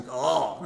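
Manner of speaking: "Call" with a low, throat-held dark L; exaggerated back-of-the-throat L in "Call"; gagging dark L. Shown as a demonstration. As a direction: say "Call" as if retching on the final L. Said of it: "uma espécie de ânsia de vômito".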